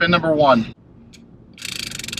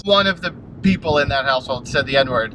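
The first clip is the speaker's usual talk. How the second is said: ordinary talk, nothing marked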